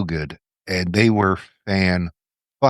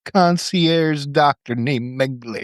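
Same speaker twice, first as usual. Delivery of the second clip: put-on slow, hammy German accent drifting New York; high, slow mock German accent; 6 semitones higher than usual. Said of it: "I was gonna do a German accent".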